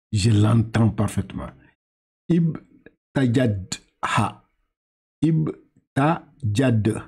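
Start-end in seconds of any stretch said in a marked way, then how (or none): 2.3-7.1 Ibtajadha sounded out syllable by syllable, very slow; slow Arabic reading. Shown as a demonstration. Teacher slowly sounding out Ibtajadha for the student. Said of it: "Très bien".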